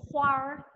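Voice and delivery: huar with the r curled at the end; Mandarin demo word. Shown as a demonstration. Teacher models huar for students, clear and careful.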